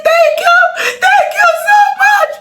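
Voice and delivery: very high, wailing, crying and laughing; overwhelmed crying, voice cracking high; sobbing thanks. Real, not acted. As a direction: pour out thanks through sobs. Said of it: "I just started crying".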